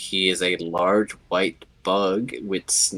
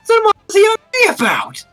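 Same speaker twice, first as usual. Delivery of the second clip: mock angry; 6 dB louder, much faster than usual. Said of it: "he's getting mad again".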